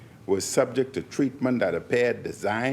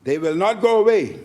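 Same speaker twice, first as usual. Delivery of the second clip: emphatic; 7 semitones higher, 6 dB louder than usual. Real, not acted. Parliamentary speech climax.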